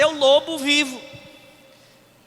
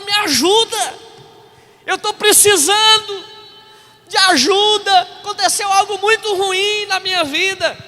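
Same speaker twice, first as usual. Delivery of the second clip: higher, louder mock plea for help; retold tearful plea; 8 semitones higher, 5 dB louder than usual. Pastor retelling a crying stranger's plea at the bus stop, imitated pleading. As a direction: imitate a man pleading, without real tears. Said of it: "o cara começou a chorar".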